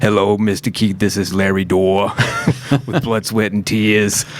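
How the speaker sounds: lightly voiced formal manager; a hint of a stiff phone caller; manager impression. Band manager calling Keith, a light impression. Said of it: "he's gonna be mad that I did this impression".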